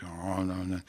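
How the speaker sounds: low, soft acted grumbling; grumpy murmuring. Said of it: "we murmur".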